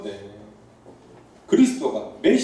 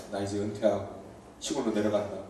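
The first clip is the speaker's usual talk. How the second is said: retiring teacher; 10 semitones lower, 11 dB quieter than usual. Retold or imitated. Preacher voices a teacher telling students he is retiring, low and soft. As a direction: lower and softer, a teacher calmly announcing his retirement. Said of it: "선생님이".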